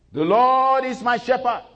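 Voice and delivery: high, loud and slow, proclaimed; preaching recital. Acted. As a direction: declaim it to the congregation. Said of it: "I want you to say it with me".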